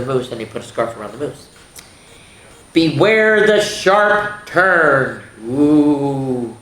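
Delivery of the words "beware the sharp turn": very slow, loud "Beware the sharp turn"; slow ominous warning. Shown as a demonstration. Teacher reading the warning slowly to the children. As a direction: warn them, drawn out. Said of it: "Turn is a bird".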